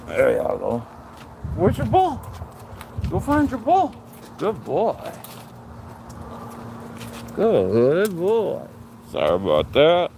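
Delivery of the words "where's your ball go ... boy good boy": high sing-song praise for the dog; dog talk. Real, not acted.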